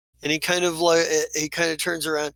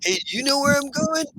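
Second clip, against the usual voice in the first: high, raised-pitch goblin question; 10 semitones higher than usual. Acted. Lost goblin asking his follower for directions, high and puzzled. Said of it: "He's like".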